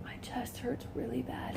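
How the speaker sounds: hushed, barely voiced retelling; weak pained complaint. Patient telling husband about chest pain, weakly. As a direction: say it faintly, as if hurting.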